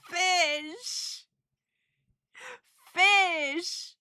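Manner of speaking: high, choked-up little squeal; getting emotional. Real, not acted. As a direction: squeak the word out, overcome with feeling. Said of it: "I'm getting emotional now".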